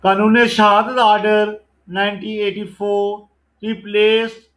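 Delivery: loud, deliberate MCQ recitation; loud slow quiz reading. Acted. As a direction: call out the question and option slowly. Reads MCQ question and answer.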